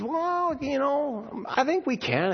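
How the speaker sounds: put-on high, soft kid's voice pleading; little boy voice. Preacher voicing little brother begging to ride, high and eager. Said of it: "And he says".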